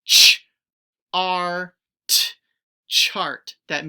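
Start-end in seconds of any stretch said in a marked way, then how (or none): 0.1-1.7 sounded out, louder, sound by sound; stretched loud sounds. Shown as a demonstration. Teacher sounding out the word loudly for the class. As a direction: belt out each sound apart. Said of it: "The word is chart".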